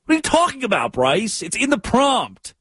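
high, sharp disbelief; incredulous. Real, not acted. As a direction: jump up in disbelief. Incredulous rant.

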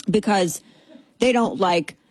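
normal talking voice

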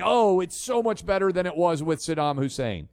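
ordinary delivery

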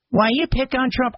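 high, indignant objector; lightly voiced defensive caller; imagined critic. Host voicing a critic defending Trump, high and indignant.

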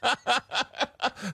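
high burst of laughter; laughing. Real, not acted. Host cracking up at guest's Lake America joke, laughing.

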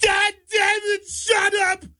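high, slow shrieking yell; teacher yelling. Retold or imitated. Comedian imitating a teacher yelling at noisy kids, high slow shriek. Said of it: "when that teacher yelled".

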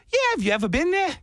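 high, fast mock Australian; fake Australian accent. He quizzes strangers about Sydney, high fake Australian accent. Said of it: "you're slipping on your accent".

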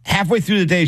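usual speaking voice

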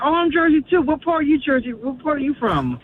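high, eager rush of questions; excited. Retold or imitated. Caller acting out meeting a fellow Jersey native, excited. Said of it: "I get so excited".

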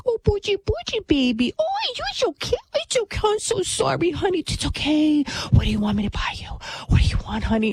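coddling mom. Retold or imitated. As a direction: mimic a mother cooing over her whiny son. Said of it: "the mom is like".

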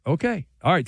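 ordinary talk

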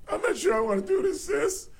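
put-on a jittery teenager wanting off the ride; nervous teen boy. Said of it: "let me do the impersonation".

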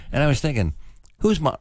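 usual speaking voice

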